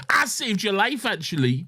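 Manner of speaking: a touch of a northern British grumble; lightly voiced angry woman with a vague accent; mock regional accent. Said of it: "I don't know what accent she had".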